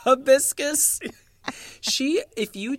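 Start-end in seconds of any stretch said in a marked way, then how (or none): 0.0-1.0 high, loud incredulous "Hibiscus?" with a rising end; baffled echo. Retold or imitated. Podcaster echoes the word in high, loud mock disbelief. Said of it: "I was like".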